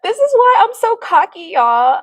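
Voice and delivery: high, very loud gloating; cocky elation. Real, not acted. Streamer boasting to viewers after failed insult, loud elation. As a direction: crow it to everyone at full volume.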